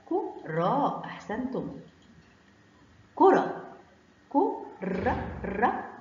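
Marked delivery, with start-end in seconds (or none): 4.3-5.9 كرة split slowly into "ku... ra"; very slow, syllable-by-syllable كرة; sounded out. Shown as a demonstration. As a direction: break كرة into two syllables with a pause between. Teacher sounds the word out.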